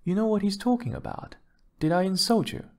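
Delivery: high-pitched, quick protest; helpless appeal. A narrator's reading. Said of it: "with a helpless sort of expression".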